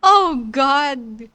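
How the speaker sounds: normal talking voice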